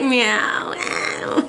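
mimicked drawn-out cat meows. Cat answering the person who calls it, meowing. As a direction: meow twice, the second dropping away. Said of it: "And the cat's like".

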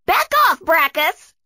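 very high-pitched heroine command; firm demand. A narrator's reading. Tia confronts Brachus, demanding. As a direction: order the bully back in a high voice. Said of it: "demanded Tia".

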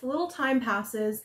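plain reading voice

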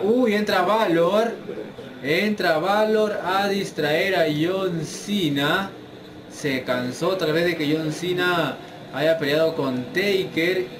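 excited ringside play-by-play; wrestling commentator. Acted. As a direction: call the match like a wrestling announcer. Live play-by-play of the match.

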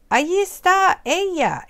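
high bird voice calling out in delight; excited exclamation. Acted. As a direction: shout with joy at spotting mom. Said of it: "exclamó el pajarito".

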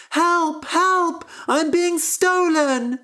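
high, loud cry for help; screaming harp. Acted. Stolen harp calls for help, high loud scream. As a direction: shriek it out high and slow. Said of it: "screamed the harp".

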